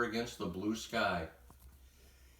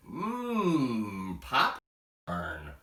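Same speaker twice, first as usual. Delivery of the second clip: savoring smell; much slower than usual. Acted. Animals smelling popcorn.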